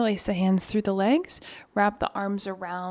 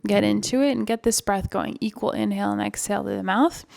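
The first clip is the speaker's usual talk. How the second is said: coaching over effort; 7 dB louder than usual. Real, not acted. Pushing class through hard squat.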